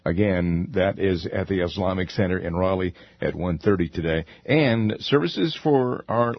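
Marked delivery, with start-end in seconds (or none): none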